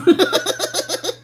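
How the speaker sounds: high laugh. Real, not acted. Laughing at refusing to argue, high giggle.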